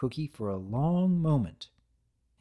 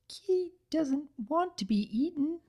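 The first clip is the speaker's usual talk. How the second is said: very high, small creature voice; tiny cookie creature; 14 semitones higher than usual. Acted. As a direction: speak like a tiny living cookie. The cookie pleads.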